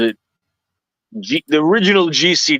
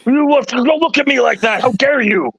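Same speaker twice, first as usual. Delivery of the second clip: sudden arrogant rage; 8 semitones higher than usual. Retold or imitated. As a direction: flip into a haughty high-pitched snap. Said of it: "the A D D switch, just flipped".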